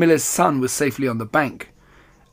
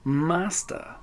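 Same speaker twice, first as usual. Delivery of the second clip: purring; 4 semitones higher, 6 dB quieter than usual. Acted. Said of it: "purred the cat".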